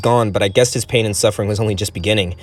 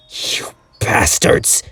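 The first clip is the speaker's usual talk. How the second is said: hissed curse, slow and breathless; angry whisper; much slower than usual. Acted. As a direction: spit the insult out as a slow whisper. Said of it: "he swore angrily".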